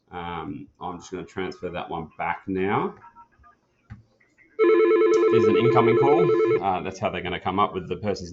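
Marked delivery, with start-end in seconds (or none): none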